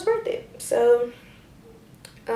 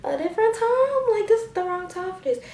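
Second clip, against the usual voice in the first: exasperated rant; 10 semitones higher than usual. Retold or imitated. Ranting about wrong timing.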